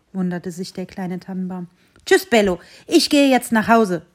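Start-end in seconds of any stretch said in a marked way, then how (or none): 2.0-4.0 loud farewell call. Acted. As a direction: call it out to lure the dog. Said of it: "rief der Mann".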